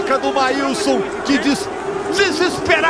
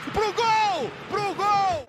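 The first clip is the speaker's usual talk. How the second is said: excited call; 8 semitones higher, 8 dB quieter, much slower than usual. Real, not acted. Narrator calls the goal.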